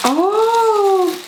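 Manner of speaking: high, delighted "gorge" with a rise; thrilled gasp. Real, not acted. She finds the Hermes lipstick, a high thrilled swoon. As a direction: swoon over the gift.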